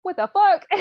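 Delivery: high, loud mock shock; shocked outburst. Count finding the page under the cloth, loud shock. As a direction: blurt it out in disbelief. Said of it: "he's all like".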